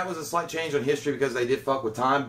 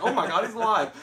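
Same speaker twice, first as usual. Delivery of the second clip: imitated cry of amazement, pitched up; high, exaggerated shock; mock astonishment; 7 semitones higher than usual. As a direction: call out a hammed-up discovery. Repeats imitation of rescuer.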